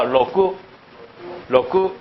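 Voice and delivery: 로쿠 in two separate open syllables; 로쿠 with the final k given its own vowel; two-syllable Japanese pronunciation. Shown as a demonstration. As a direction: model 로쿠 as ro-ku, no closed final consonant. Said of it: "발음을 두 번 하는 겁니다".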